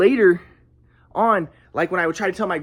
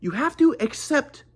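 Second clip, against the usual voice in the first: higher, preachy mock-calm voice; mock guru grandpa; 6 semitones higher than usual. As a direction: parody the spiritual grandpa soothing. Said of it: "my grandpa's like".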